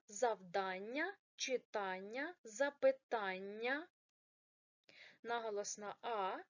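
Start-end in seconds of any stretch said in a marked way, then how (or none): 0.1-3.9 "запитання", "читання" slow, stress on the "та" syllable; correct stress. Shown as a demonstration. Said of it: "як правильно". (повільно, з наголосом на "та": "запитання", "читання")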